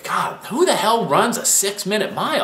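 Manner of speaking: high-pitched mock incredulity; retold disbelief. Runner recalling his old view of six-minute miles, incredulous. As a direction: quote your old self, voice lifted in disbelief.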